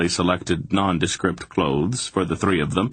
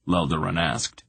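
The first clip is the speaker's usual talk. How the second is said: ordinary delivery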